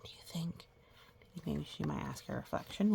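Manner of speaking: hushed, secretive whisper. Real, not acted. Teacher whispering to the children over the picture, hushed.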